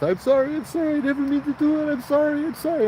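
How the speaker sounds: very high, frantic sorry; panicking apology. Retold or imitated. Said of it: "the guy started panicking".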